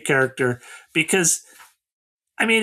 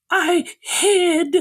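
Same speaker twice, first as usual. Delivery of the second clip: high, tearful imitation whine; pitched-up, pitiful mock admission; mock ashamed confession; 11 semitones higher than usual. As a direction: confess it in a hammed-up whimper. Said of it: "as if he's ashamed of himself".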